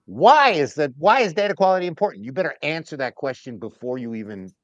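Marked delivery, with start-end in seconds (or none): 0.0-2.2 louder, higher skeptical questioning; a CEO grilling the data person, loud and pointed; demanding executive. Retold or imitated. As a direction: press hard like an impatient CEO. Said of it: "they'll go".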